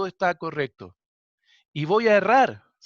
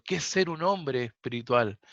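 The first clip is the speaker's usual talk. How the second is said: ordinary talk, nothing marked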